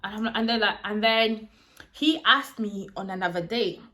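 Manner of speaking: normal talking voice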